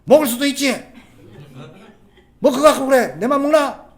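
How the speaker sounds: loud, high-pitched indignant outburst; defensive anger. Acted. Husband caught drinking shouts excuses at his wife, angrily defensive. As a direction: yell back like someone caught out. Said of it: "지가 화를 내요".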